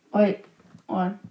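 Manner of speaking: usual speaking voice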